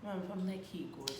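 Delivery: soft, deflated I'm-fine; quiet hurt denial. Retold or imitated. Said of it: "I was just like".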